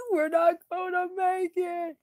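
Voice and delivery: high, fast sobbing lament; high tearful sobbing wail; crying. A narrator's reading. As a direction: Bilbo crying in despair. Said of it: "Bilbo started crying".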